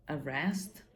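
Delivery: ordinary talk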